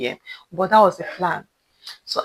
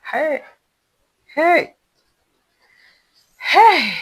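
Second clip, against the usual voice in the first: high, drawn-out "hey", growing louder; calling out; 9 semitones higher, 8 dB louder, much slower than usual. Acted. Seller calling the no-show stylist, insistent high calls. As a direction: shout for someone who won't answer. Calling the man who won't answer.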